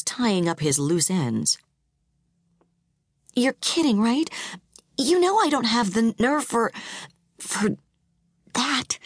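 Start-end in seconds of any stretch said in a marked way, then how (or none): 3.3-9.0 high, shaky, faltering protest; terrified. A narrator's reading. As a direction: stammer out your refusal. Wife refusing, terrified.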